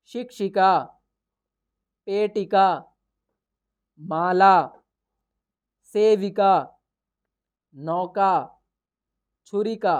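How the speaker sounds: each word spoken slowly and loudly with long pauses; clear word-by-word reading. Shown as a demonstration. Teacher reading the word list aloud, slow and clear. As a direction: read every word out carefully for the class to repeat. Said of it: "बोल बोल करके देखना है कि हम सही उच्चारण कर पाते हैं".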